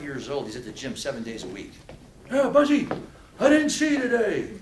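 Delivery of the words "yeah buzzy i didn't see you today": high, loud mock guilt-trip from an old gym buddy; playful put-on old-timer scolding; guilt-tripping old man. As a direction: call out like a nagging old pal, pitched way up. Old gym friend guilt-trips him.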